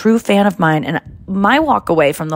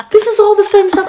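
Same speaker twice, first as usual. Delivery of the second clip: much higher, louder laughing squeal; giddy disbelief; 6 dB louder than usual. Retold or imitated. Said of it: "I go".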